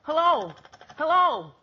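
loud, high yelps into a dead phone line; dismayed boy shouting down the phone; frantic calling. Acted. Calls into phone after hang-up.

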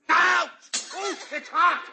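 high sudden yelp of pain. Acted. Dad burning his hand on the tea, yelping. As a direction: jerk back from the burn. Burned by hot tea glass.

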